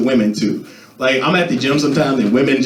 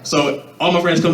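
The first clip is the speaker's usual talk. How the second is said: ordinary delivery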